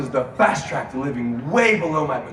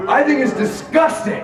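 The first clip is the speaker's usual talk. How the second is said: disgusted shout; 10 semitones higher, 4 dB louder than usual. Real, not acted.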